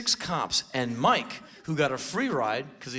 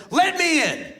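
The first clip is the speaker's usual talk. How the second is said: high, loud mock intruder yell; retold belligerent demand; 8 semitones higher, 6 dB louder than usual. Acted intruder demanding to get in.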